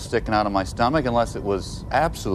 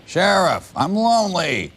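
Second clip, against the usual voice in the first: high, louder lonely plea; mock whine; 9 semitones higher, 3 dB louder, slower than usual. Dubbing a lonely sheriff calling out, high whine. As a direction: call out like a needy child.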